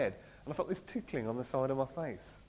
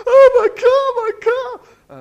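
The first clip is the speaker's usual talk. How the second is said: very high, loud, slow anguished wail; wailing over car; 20 dB louder, much slower than usual. Retold or imitated. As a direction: cry out over a wrecked car. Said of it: "and going".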